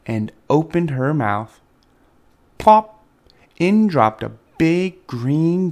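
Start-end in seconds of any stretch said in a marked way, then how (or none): 2.6-3.0 high, loud popped sound effect; punchy, higher-pitched plop. A narrator's reading. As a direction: pop it out suddenly. Sound of food dropping.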